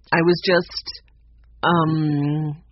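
hesitant, drawn-out um; trailing away. Acted. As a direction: falter and let the voice trail away. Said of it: "his voice trailed away".

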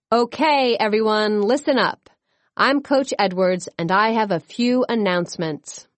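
ordinary delivery

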